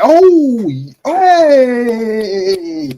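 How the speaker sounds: high, loud happy shout; excited hey. Real, not acted. Arsenal fan spotting a new signing, delighted. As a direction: yell with delight.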